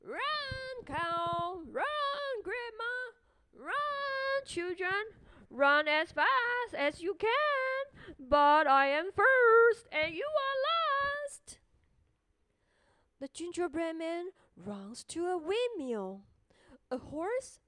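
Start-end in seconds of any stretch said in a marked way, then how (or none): 0.0-11.6 high, loud, slow taunting call; taunting shout. Acted. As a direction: yell it like a cheeky runaway. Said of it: "laughs and shouts".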